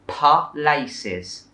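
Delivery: "pa-laces" split as pa-lay-siz; extra vowel after the p in "pa-laces"; three syllables. Shown as a demonstration. Teacher mimics the slip, mispronounced. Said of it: "add the syllable at the start".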